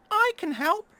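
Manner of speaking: very high, tiny mouse voice. Acted. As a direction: squeak it like a little mouse. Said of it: "said a tiny voice".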